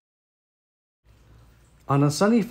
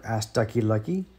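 ordinary delivery, nothing marked

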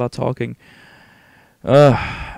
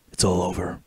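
low hero voice; 5 semitones lower, 4 dB quieter than usual. Acted. Game hero answering the questioner, low voice. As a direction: lower put-on game-hero voice. Voicing Snake's reply in game.

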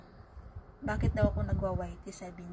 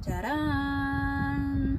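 ta-da reveal; 5 semitones higher, 4 dB louder than usual. Real, not acted. Unveiling the new purchase.

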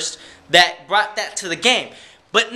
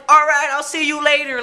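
higher, offhand mock farewell; breezy reply; 7 semitones higher than usual. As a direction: shrug it off lightly. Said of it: "And I'm like".

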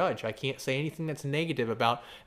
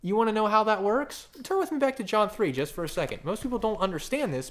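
ordinary talk, nothing marked

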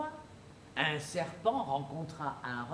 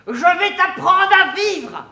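loud, slow, high threat; cried out; 11 semitones higher, 17 dB louder than usual. Acted. The fox draws a knife and cries out a loud threat. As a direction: bellow it slowly, very high. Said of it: "et s'écria".

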